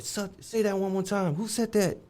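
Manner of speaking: high, put-on guard voice demanding who spoke; imitating the guard. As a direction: play the guard challenging the cell block. Guard answers the taunt.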